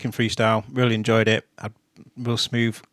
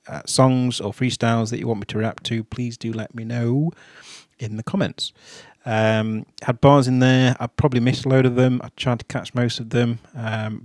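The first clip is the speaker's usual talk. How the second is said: ordinary talk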